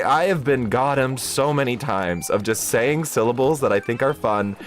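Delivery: ordinary talk, nothing marked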